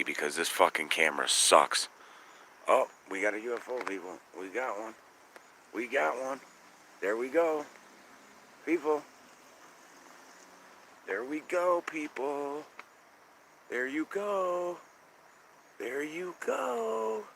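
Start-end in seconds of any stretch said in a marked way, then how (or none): 2.7-12.6 getting more thrilled as it seems to appear; excited UFO spotting. Real, not acted. 13.7-17.2 very high and slow, stretched out; drawn-out cheer. Real, not acted. Cheering the UFO on.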